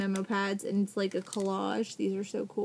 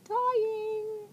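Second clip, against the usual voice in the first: excited squeal; 11 semitones higher than usual. Real, not acted. Overjoyed at the stickers.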